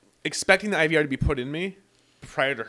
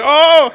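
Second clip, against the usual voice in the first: sharp yelp, much higher and louder; pained yelp; 13 semitones higher, 18 dB louder than usual. Retold or imitated. Patient yelping as the doctor's instrument goes in. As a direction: let out a sudden cry of pain. Procedure hurts, retelling his reaction.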